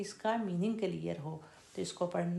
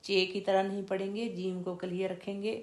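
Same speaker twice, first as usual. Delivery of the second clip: ordinary talk, nothing marked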